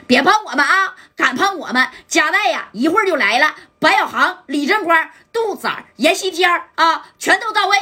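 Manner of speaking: pinned-down lackey bluffing to the boss, rising bravado; boastful bravado. Acted. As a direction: rattle off the big names to scare them. Captive boasts his backup is coming.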